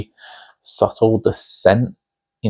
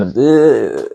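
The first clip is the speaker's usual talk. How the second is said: a raised, louder groan of disgust; disgusted ugh; 4 semitones higher, 4 dB louder than usual. Retold or imitated. Reviewer recalling her reaction to a gross movie, loud disgusted ugh.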